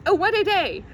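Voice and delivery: ordinary delivery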